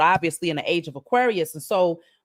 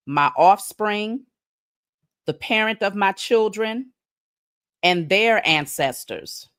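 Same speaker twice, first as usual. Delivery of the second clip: slow, deliberate declaration with long pauses between phrases; solemn declaration; slower than usual. Shown as a demonstration. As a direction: pronounce it like a vow, one phrase at a time. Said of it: "You wanna write this down on paper and burn it".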